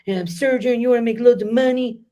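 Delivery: high, loud mock outrage; hammed-up outraged critic; mock accuser. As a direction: put on an indignant critic. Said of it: "you might turn around and go".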